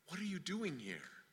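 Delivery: barely audible, very soft question; small, still voice. Retold or imitated. God answers Elijah's complaint in a small, still voice. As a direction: shrink the question down to almost nothing. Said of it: "The small, still voice said".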